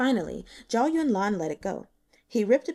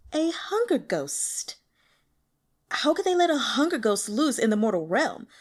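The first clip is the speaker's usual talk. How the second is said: higher, breathy then rising indignant woman's voice; exasperated phone woman; 5 semitones higher, 4 dB louder than usual. A narrator's reading. Woman on the phone scolding the Netherworld, incredulous. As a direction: hiss the name, then complain loudly.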